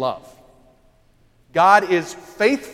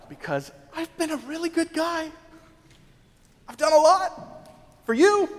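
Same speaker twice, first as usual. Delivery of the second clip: high, hammed-up self-righteous pleading; a smug, high mock prayer; mock boastful prayer; 8 semitones higher than usual. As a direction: pitch it up like a proud kid listing good deeds.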